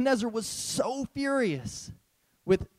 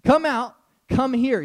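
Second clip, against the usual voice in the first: louder, higher summons; loud call; 5 semitones higher, 7 dB louder than usual. Retold or imitated. King calls the men out of the furnace, loudly. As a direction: call them out of the fire. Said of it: "he says".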